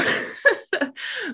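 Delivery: sudden high-pitched burst of laughter. Real, not acted. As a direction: laugh high at the memory of the pain. Laughing at feeling the pain.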